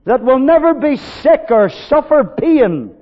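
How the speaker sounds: loud, slow, high preaching cry; preaching at full force. Acted. Preacher crying out to his congregation, full force. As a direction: ring it out over the congregation.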